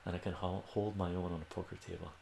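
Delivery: ordinary delivery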